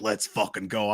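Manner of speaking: retold hero line, dropped low and soft; Wolverine. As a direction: say it low and understated. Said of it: "it pans to Wolverine".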